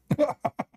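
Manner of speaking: high laugh. Real, not acted. Laughs at his punchline.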